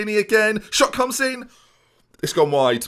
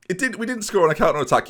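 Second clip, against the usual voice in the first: ordinary talk, nothing marked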